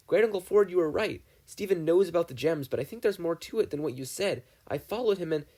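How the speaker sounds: put-on urgent boy. Dipper urgently tells Ford.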